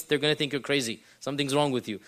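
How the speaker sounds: ordinary talk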